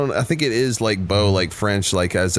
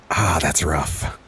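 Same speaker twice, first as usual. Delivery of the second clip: mock sympathy; 4 semitones lower, much slower than usual. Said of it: "they're always just like".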